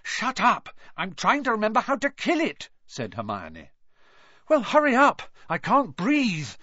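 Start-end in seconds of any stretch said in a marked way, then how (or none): 0.0-2.7 high-pitched, hurried retort; flustered snapping. A narrator's reading. Hermione snaps back under pressure. 4.5-6.5 high, strained plea, slowing at the end; as if being squeezed breathless; gasping. A narrator's reading. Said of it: "Harry gasped".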